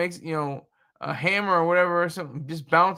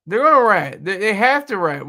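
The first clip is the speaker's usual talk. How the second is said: emphatic; 3 semitones higher, 6 dB louder than usual. Real, not acted. Emphatic prediction.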